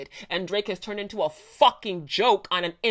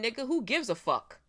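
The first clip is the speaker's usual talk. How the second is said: ordinary talk, nothing marked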